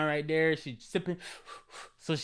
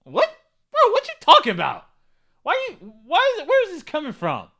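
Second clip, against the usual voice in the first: put-on high, loud, quick bewildered girlfriend; girlfriend's confused reaction; 10 semitones higher, 9 dB louder than usual. The girlfriend answering her man's blanket statement, high and bewildered. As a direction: play her baffled at the out-of-nowhere remark. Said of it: "she probably been like".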